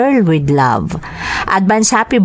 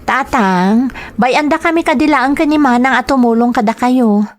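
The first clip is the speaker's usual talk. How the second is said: much higher, concerned daughter; a daughter pleading with her old father, pitched well up; 7 semitones higher, faster than usual. Acted. Daughter worrying her father is ashamed on the road, high pleading voice.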